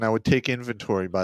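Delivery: ordinary talk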